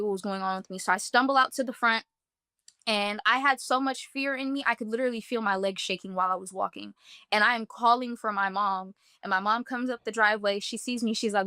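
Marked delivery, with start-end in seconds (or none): none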